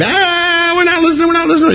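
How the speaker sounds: high squealing, refusing to listen; mock mob screaming. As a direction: scream like a rushing mob, hammed-up and high-pitched. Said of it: "These guys all start screaming".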